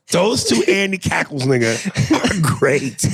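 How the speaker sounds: laughing. Real, not acted. Laughing through the line.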